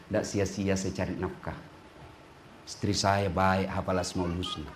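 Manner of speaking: low, soft contented murmur; husband's inner voice. Acted. Husband thanking God for his wife, softly.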